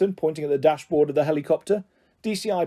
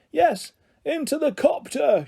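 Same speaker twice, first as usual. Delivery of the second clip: high, slow group call; shouting; 11 semitones higher, slower than usual. Acted. Grandparents shouting to Sam from the helicopter, eager. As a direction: cheer him up into the copter. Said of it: "shouted various grandparents".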